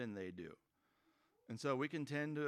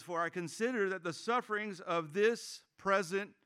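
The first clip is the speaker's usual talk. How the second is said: higher, louder reading of the verse; scripture reading; 6 semitones higher, 7 dB louder than usual. Acted. Pastor reading verse eighteen aloud, proclaiming. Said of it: "It says".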